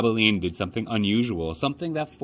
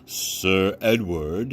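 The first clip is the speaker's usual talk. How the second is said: mocking father; much slower than usual. A narrator's reading. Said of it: "presented it with a mocking bow".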